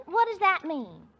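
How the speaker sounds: ordinary talk, nothing marked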